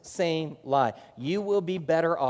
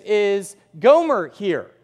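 slow, louder and deliberate; Hosea asking; 3 semitones higher, 9 dB louder, much slower than usual. Retold or imitated. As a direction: ask it slowly, steadily. Hosea asks for his wife.

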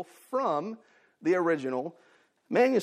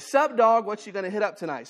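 slang greeting; 3 semitones higher than usual. Retold or imitated.

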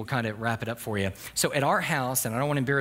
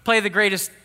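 louder, higher call across the car; calling out to Alexa; 7 semitones higher, 7 dB louder than usual. Retold or imitated. Father calling out to Alexa in the car, raised voice. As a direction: call the smart speaker over the noise. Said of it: "call out to Alexa and say".

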